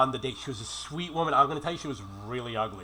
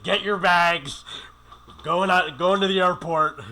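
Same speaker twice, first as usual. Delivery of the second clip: louder, slow commanding order; firm order; 3 semitones higher, 6 dB louder, slower than usual. Retold or imitated. Telling the woman on his bed to leave, firm loud order. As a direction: lay down the law, slowly.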